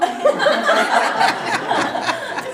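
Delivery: a sudden high laugh bursting out through tears; choked-up nervous laugh, real emotion; teary laugh. Real, not acted. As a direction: let the laugh break out as you fight back tears. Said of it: "Oh, I'm gonna cry".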